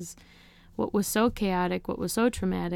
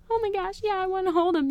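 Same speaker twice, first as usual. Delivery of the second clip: much higher, gushing and eager; overjoyed; 13 semitones higher, 3 dB louder, faster than usual. Retold or imitated. Mother answering the nurse, high and overjoyed. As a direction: burst out with delight. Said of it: "And I'm like".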